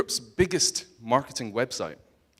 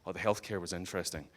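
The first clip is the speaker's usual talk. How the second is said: ordinary talk, nothing marked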